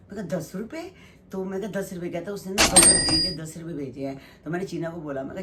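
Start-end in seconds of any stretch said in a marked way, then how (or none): 0.4-0.9 surprised disbelief. Retold or imitated. Mother hears only ten rupees sent.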